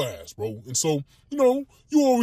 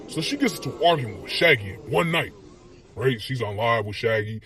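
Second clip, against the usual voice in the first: normal talking voice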